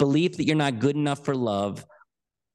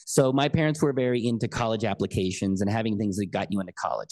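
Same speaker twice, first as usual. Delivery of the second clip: normal talking voice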